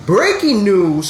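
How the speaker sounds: usual speaking voice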